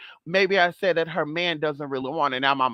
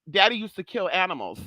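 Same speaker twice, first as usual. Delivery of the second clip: ordinary talk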